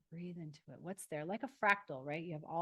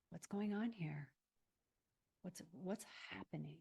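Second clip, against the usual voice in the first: gentle inner question; 8 dB quieter than usual. Shown as a demonstration. Models gentle question to oneself.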